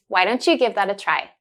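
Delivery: usual speaking voice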